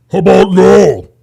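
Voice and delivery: loud, flat shouted no; shouted dismissal; loud retort. Real, not acted. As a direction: bark the answer back.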